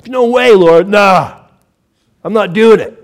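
a loud, pouting no to the Lord; defiant refusal. Acted. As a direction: blurt it out like a stubborn child. Peter voiced refusing Jesus.

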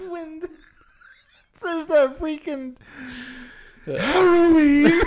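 high loud laughing squeal, drawn out; loud laughing outburst. Real, not acted. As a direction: burst out laughing through the word. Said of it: "that was so loud".

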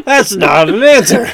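exasperated laugh through words. Acted. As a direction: scold him while cracking up.

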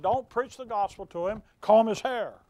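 loud, mocking the divine order; preacher lampooning a claimed word from God, loud; booming mock command. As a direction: bellow it as a ridiculed voice of God. Mock-voicing God's reply.

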